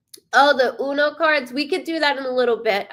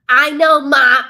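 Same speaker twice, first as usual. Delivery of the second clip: loud retort to mom; 3 semitones higher, 10 dB louder, slower than usual. Real, not acted. Answering her late mother aloud.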